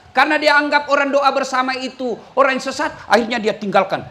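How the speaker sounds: normal talking voice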